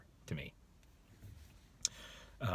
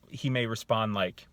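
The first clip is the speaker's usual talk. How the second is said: ordinary talk, nothing marked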